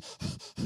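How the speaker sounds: imitated hitching sob-breaths; tearful gasping; catching breath. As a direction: suck in air between sobs. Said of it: "she catches her breath".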